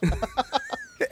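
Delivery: high amused laugh; quick laugh. Real, not acted. Laughing at son's business plans.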